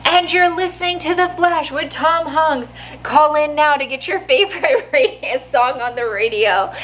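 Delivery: high, bright-announcer radio host patter. Retold or imitated. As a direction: hype it up like a goofy radio DJ. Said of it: "Tom Hanks is radio D J".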